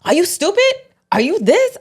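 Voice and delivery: high-pitched mock outburst; losing his cool. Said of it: "lose his cool before".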